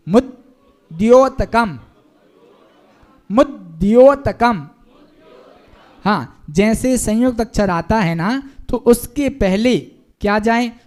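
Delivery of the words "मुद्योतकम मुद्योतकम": मुद्योतकम very slow, louder, मुद्-द्योतकम; doubled da, slow. Shown as a demonstration. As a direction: lean into the double द, slowly. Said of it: "दो बार दा आएगा". (मुद्योतकम बहुत धीरे, द्द साफ़)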